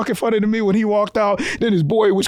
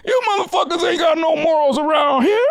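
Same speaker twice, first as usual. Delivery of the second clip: mock scolding; 6 semitones higher than usual. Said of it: "He was just saying".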